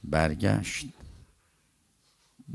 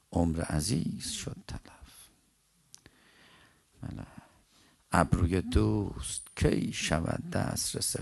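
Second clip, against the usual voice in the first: poem recitation; 2 semitones lower, 4 dB quieter, slower than usual. Acted. Preacher pauses the tale to recite a love poem, slow soft recitation. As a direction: recite the verse slowly and softly, lingering on each phrase.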